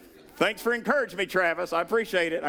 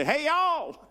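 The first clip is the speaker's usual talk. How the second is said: Southern drawl; 9 semitones higher, much slower than usual. Retold or imitated. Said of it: "Down south, we say".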